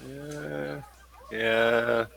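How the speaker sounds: usual speaking voice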